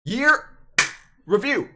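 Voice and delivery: high, loud, punched catchphrase; mock show announcer. Vlogger interrupts to do a meme bit, mock announcer. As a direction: shout it out like a show title.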